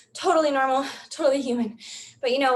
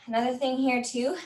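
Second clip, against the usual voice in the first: ordinary talk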